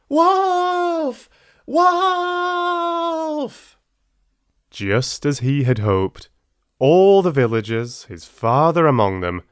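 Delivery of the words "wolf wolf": put-on high, loud boy's cry of wolf; loud shout. A narrator's reading. Boy fakes an alarm to the villagers, high shout. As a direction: yell it over the hill as a prank. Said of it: "shouting as loud as he could".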